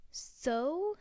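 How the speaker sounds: sharp loud snap; snapping. A narrator's reading. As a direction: bite the word off. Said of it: "snapped Miss Dursley".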